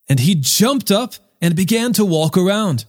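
plain reading voice

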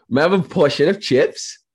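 high-pitched mock English accent; attempted English accent. Shown as a demonstration. American guest asks for chips on request, bad English accent. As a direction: have a go at a polite English accent. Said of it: "English accent".

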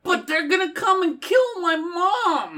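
put-on high, desperate kid voice; wholesome 1950s kid. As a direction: plead like a scared farm boy. Kid voice in Western bit.

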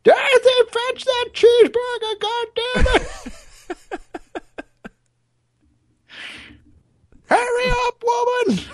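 put-on high, loud bossy old codger; hammed-up old man yelling at his wife; cranky old man. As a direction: bark orders like a grumpy grandpa. Mock old man bossing Dorothy.

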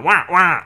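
loud, high "wah, wah" squawk; Penguin squawk. Retold or imitated. Podcast host imitating Penguin's catchphrase, loud squawk. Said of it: "he is going".

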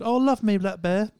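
high, loud mock Southern English accent. Mocking how Southerners would say the band's name, in a Southern English accent. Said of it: "that's what people would normally say".

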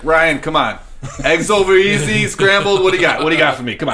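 loud mock heckle from the crowd; calling out from stands. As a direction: shout to him across the stadium entrance. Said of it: "be like".

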